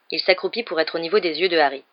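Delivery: ordinary delivery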